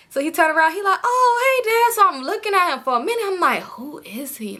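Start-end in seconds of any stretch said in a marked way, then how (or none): 1.0-1.9 high-pitched mimicked son; high, caught-off-guard greeting in retelling; surprised greeting. Voicing son greeting his father. 3.6-4.6 hushed whisper to oneself; puzzled, voiceless wondering; whispered thought. Retold or imitated. Acting out private thoughts.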